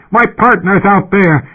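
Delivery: high, loud, slow yell into the mic; desperate yell into the mic. A narrator's reading. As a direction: shout it over the alarm. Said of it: "He yelled into the mic".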